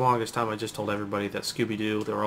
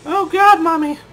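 much higher, louder panicked scream; mock terror; 11 dB louder, slower than usual. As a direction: shriek for mommy at the zombies.

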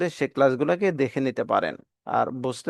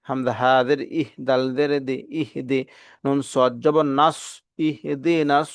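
ordinary talk

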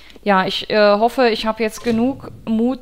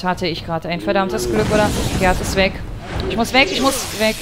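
ordinary talk, nothing marked

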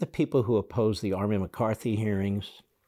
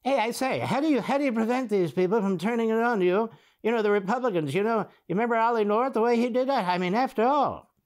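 high, hammed-up British accent; mock British accent; 10 semitones higher, 3 dB louder than usual. Host mocks interviewer questioning congressman, mock British accent. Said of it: "in his British accent".

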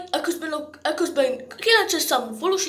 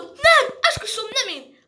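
high-pitched protest with a loud first cry; desperate denial; 6 semitones higher, 3 dB louder than usual. Acted. Interrogated suspect swearing "not me", desperate. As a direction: swear innocence in a panic.

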